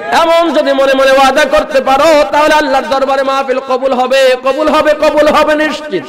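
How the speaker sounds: high, loud, chanted appeal; full-force preaching. Acted. Preacher pleading with the young men, high and loud. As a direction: cry out to the young men in a sung pitch.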